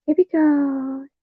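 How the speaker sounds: very high, drawn-out put-on voice; tippy-toe character. Figure back on tippy toes, high put-on voice. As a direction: stretch it slowly in a high cutesy voice.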